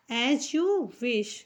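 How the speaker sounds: "As you wish" stretched out word by word; slow dictation. Shown as a demonstration. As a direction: spell out "As you wish" very slowly for learners.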